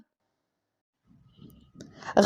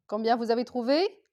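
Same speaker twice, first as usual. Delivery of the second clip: ordinary talk, nothing marked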